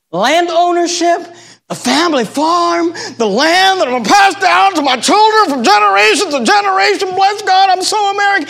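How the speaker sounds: high, exaggerated patriotic gushing; mock proud patriot. Preacher mimics believers proud of the family farm, high mocking boast.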